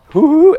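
high playful hoo hoo; a friendly dog's hoot, pitched high. Retold or imitated. Pastor voicing Bob the dog at the leash's edge, high hoots. Imitating dog Bob's greeting.

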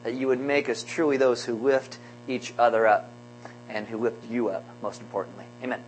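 soft, hushed closing prayer; quiet prayer. Acted. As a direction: pray it gently and low.

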